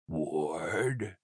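low, soft, drowsy murmur; half-awake question, low and soft; sleepy. A narrator's reading. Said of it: "Mason's voice was sleepy".

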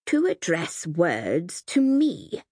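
very slow, quiet menace; slow and soft. Acted. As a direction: stretch each word, barely above a hush. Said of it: "so slowly, so softly".

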